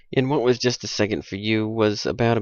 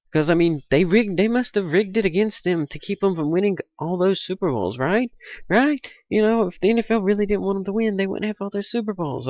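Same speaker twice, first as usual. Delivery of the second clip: higher, needling sarcasm; real sarcastic rant, right? pitched up; 8 semitones higher than usual. Real, not acted. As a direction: rhetorical jab at Steelers fans. Sarcastic rant at Steeler fans.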